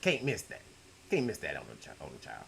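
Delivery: normal talking voice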